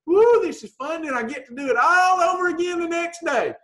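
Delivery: high, bright cheer about the diet plan; excited. Pretend. Coach shows dieters the right attitude, excited and high. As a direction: cheer it like the easiest thing ever.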